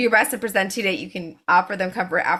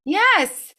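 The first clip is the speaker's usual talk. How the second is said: excited gratitude; 5 dB louder, slower than usual. Real, not acted. Guest thrilled by the advice.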